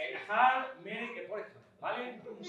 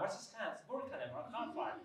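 fake foreign language; 6 dB quieter, slower than usual. Shown as a demonstration. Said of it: "hablando en guachi guachi, en un idioma inventado".

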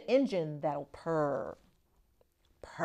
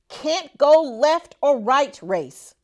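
ordinary delivery

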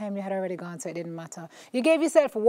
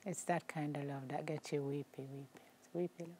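much softer, lower, near tears; soft and moved; 6 semitones lower, 15 dB quieter than usual. Real, not acted. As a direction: hush down, touched. Moved by touching story.

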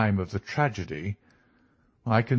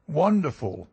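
higher-pitched exclamation of admiration; country doctor; 6 semitones higher than usual. A narrator's reading. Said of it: "said the country doctor".

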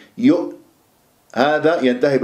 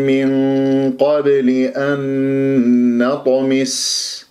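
slow measured tajwid chant; Quran recitation; much slower than usual. Shown as a demonstration. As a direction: recite the verse slowly with full madd. Recites the full verse.

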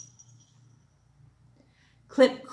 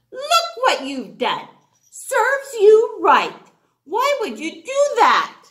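scolding; 9 semitones higher, 6 dB louder than usual. Acted. Seahorse scolding crab in argument.

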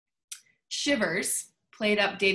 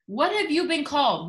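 ordinary delivery

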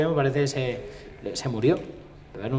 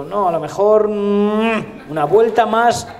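insistent coaxing; 6 semitones higher, 6 dB louder, slower than usual. Acted. Acted nervous request for another turn.

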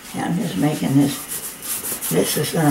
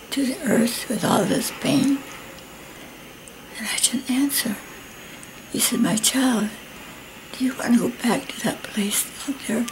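whispering; 6 semitones higher, 5 dB quieter than usual. Retold or imitated. Whispered retelling of vision.

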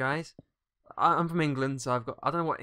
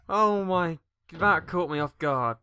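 ordinary talk

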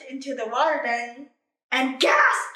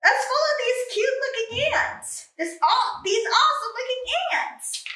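excited panda; 11 semitones higher, 3 dB louder than usual. Acted.

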